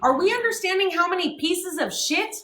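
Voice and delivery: ordinary talk, nothing marked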